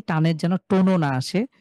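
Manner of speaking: ordinary talk, nothing marked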